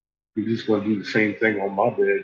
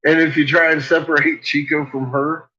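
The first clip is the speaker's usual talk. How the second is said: normal talking voice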